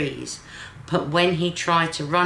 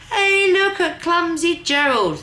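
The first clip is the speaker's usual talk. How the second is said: mocking animals; 11 semitones higher, 5 dB louder than usual. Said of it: "the animals all laughed".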